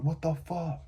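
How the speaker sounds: high, outraged protest; hammed-up indignant complaint at the ref; mock protest. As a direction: play the fighter who can't believe the stoppage. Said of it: "That's someone protesting there".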